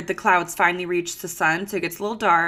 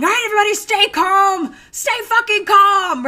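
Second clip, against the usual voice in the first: much higher, louder frantic boss voice, slowing at the end; Michael Scott panic; 12 semitones higher, 5 dB louder than usual. Retold or imitated. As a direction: yell for calm while panicking yourself. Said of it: "She was like Michael Scott".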